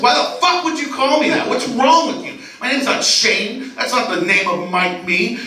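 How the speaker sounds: high-pitched mock outrage; yelling. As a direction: rant like an offended date, hammed up. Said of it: "just yelling".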